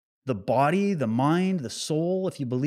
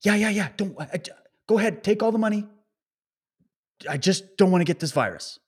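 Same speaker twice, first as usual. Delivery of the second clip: higher, nervous pushover voice; lightly mocking a timid victim; mock compliant victim; 6 semitones higher than usual. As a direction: play the scared pushover handing it over. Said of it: "And you went".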